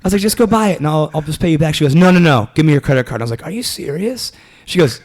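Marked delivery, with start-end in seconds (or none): 0.2-1.7 exasperated plea. Retold or imitated. Retold exasperated plea to girlfriend. 1.9-3.2 insistent demand. Retold or imitated. Retold girlfriend demanding his card. 3.4-4.3 high-pitched retold disbelief; incredulous. Retelling his reply to girlfriend's demand, incredulous.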